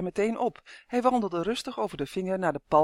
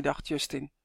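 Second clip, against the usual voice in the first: usual speaking voice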